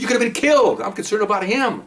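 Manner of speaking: very high-pitched, worried scolding; retold yelling. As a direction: act out yelling at him out of concern. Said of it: "now I'm yelling at him".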